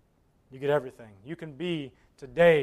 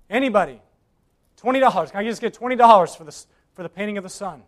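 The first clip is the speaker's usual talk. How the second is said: quick, brisk auctioneer call; 4 semitones higher, 7 dB louder, faster than usual. Acted. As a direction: rattle off the opening bid. Auctioneer pleads for a bid.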